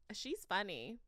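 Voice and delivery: normal talking voice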